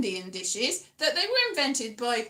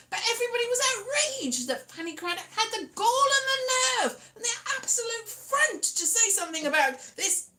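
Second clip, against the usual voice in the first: mock outrage; 7 semitones higher than usual. Telling viewers about the scandal with mock outrage. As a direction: pretend to be scandalised on the nation's behalf.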